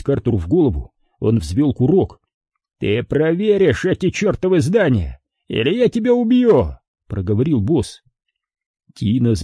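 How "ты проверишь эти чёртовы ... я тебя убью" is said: high-pitched, heated ultimatum; furious warning from a boss; angry threat. A narrator's reading.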